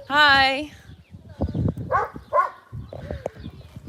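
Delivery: high sing-song hello; high animal greeting. Real, not acted.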